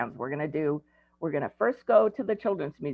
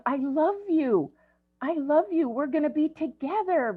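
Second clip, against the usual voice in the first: soothing; 9 semitones higher than usual. Retold or imitated. Grandparent soothing crying child.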